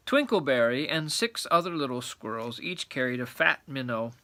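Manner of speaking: ordinary delivery, nothing marked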